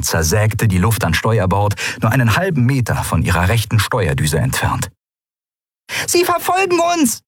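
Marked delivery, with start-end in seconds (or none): 6.1-7.2 tiny, very high creature voice crying out; a little being's high, urgent call; small creature calling. A narrator's reading. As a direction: Nelen warning the pilot, high and urgent. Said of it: "rief Nelen".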